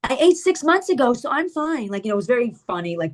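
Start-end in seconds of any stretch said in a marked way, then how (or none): 0.0-1.9 high-pitched mocking imitation; over-the-top sibling parody; sarcastic. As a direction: mimic the sister in a raised, mocking voice. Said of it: "his so sarcastic".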